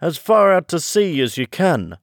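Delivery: storybook excitement, high and swooping; excited calling out. A narrator's reading. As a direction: carry on the rush of excitement, pitched high. Excited interruptions about the dance.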